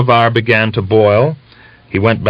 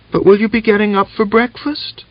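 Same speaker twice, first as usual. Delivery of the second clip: put-on much higher child voice, hopeful; a small daughter asking her sick mother; little girl. A narrator's reading.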